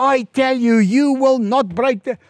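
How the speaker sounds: loud, high-pitched mimicked telling-off; retold angry order. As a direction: imitate an official forcefully forbidding it. Ambassador repeats his order, quarrel.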